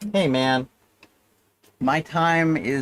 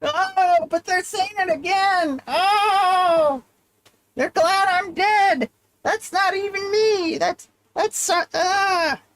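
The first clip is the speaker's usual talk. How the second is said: tormented echoing moans; slower than usual. Acted. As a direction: wail in anguish like a ghost. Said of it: "moaning and groaning like a ghost".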